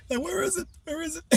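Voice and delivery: very high, soft sing-song to a cat; high cooing voice for Molly; pet baby talk. Acted.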